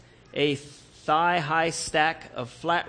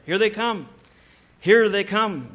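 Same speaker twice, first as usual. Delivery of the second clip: alarmed call, higher and louder, building on the repeat; urgent warning; 5 semitones higher, 7 dB louder than usual. A narrator's reading. Brother warning Joshua the enemy is coming, rising alarmed call. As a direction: raise the alarm, louder the second time.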